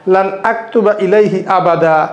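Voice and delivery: ordinary talk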